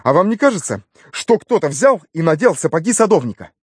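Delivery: high, brisk, insistent question; eager challenge. A narrator's reading. Nephew pitching his theory to the inspector, eagerly challenging. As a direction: push a theory with youthful excitement.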